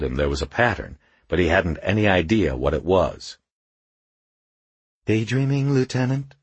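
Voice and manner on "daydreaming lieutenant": higher-pitched teasing question; lightly voiced dusky character. A narrator's reading. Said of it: "came the familiar, dusky voice".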